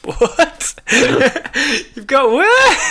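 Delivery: high, laughing what; laughing disbelief. Real, not acted. Friend laughing at a fifth eye, in disbelief. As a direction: laugh through the question.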